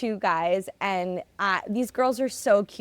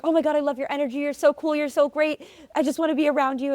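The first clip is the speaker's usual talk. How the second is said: put-on gushing girl; 7 semitones higher, faster than usual. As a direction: imitate a gushing admirer, pitched up high. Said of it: "She was like".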